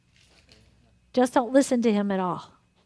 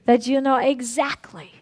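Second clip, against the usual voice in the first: earnest prayer; 2 semitones lower than usual. Acted. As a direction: pray it out for the whole room. Leads a group prayer.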